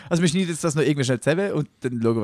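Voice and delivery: usual speaking voice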